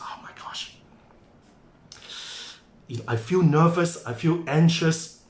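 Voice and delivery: breathy gasp, then a mildly anxious voice; acting nervous. Imitating a worried business owner, mildly anxious. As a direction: play a worried person, only a little shaken. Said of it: "feel the butterflies in your stomach".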